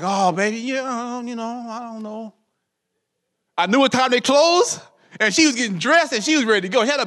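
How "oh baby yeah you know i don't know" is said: soft, drawn-out stalling to his wife; stalling sweet talk. Retold or imitated. Husband stalling his wife before closing time, soft and sweet. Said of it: "I was going real slow".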